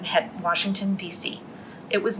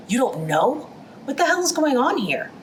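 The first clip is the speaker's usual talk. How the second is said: outraged demand; 7 semitones higher than usual. Acted. Official stonewalls the shocked committee.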